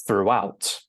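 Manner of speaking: "throughout" as "throo-WOUT", stress on "out"; second-syllable stress. Shown as a demonstration. Teacher demonstrating pronunciation, "throughout" stressed on its second syllable. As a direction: link "throughout" with a small W, stressing "out". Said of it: "we stress the second syllable".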